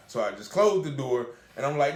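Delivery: normal talking voice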